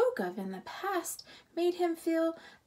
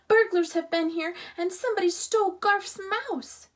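high, loud and fast alarm; 8 semitones higher, 8 dB louder, faster than usual. A narrator's reading. Girl telling the campers, loud and fast. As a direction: blurt out the news in a rush. Lena alarmed about burglars.

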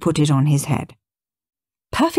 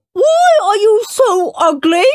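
mock Queen voice; 6 dB louder than usual. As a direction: sneer down at him as a snooty queen. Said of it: "said the Queen".

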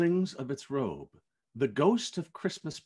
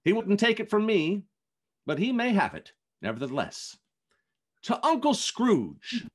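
louder, cheerful raised-glass toast; festive toast; 7 semitones higher, 5 dB louder than usual. Acted. Fred toasting the old man before his guests, cheerful and louder. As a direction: lift the room with good cheer. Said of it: "said Fred".

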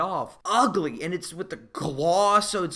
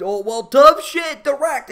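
the studio boss telling the actor to direct, loud and dismissive; blunt producer; 6 semitones higher, 8 dB louder than usual. Retold or imitated. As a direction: shut the actor down, louder. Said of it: "He's like".

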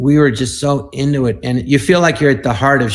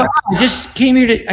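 mock dismay; 10 semitones higher than usual. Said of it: "I go".

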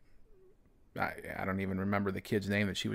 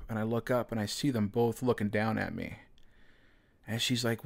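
normal talking voice

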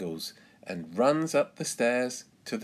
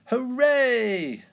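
put-on high, loud child's hooray; shrieked little-girl celebration; joyful cheer; 8 dB louder than usual. A narrator's reading. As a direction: cheer at the top of your voice. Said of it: "cheers Peppa".

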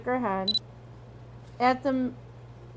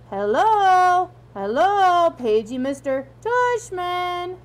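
loud, higher loudspeaker announcement with drawn-out words; loudspeaker page; 6 semitones higher, 6 dB louder, much slower than usual. Acted. Dad imagining the principal paged at school, loud announcer voice. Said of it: "hear his name being said over the loudspeaker".

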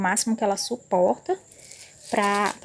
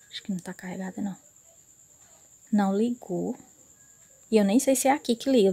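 usual speaking voice

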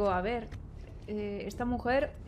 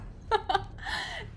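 nervous laugh-cry; 9 semitones higher than usual. Real, not acted. Laugh turning into a scared cry.

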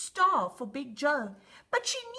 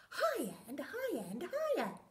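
usual speaking voice

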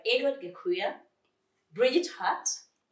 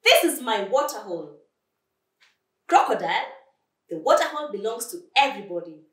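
loud, emphatic claim; quarrelling animals; 3 semitones higher, 8 dB louder, faster than usual. Acted. Quarrel over the water hole.

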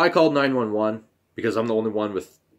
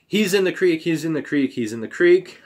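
retold scream; 7 semitones higher, 4 dB louder than usual. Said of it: "she came in the house screaming".

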